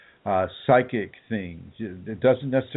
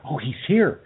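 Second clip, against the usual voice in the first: higher-pitched retold excited announcement; imitated excitement; 6 semitones higher than usual. Retelling what the woman would tell him, mock excited. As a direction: act out her excited news.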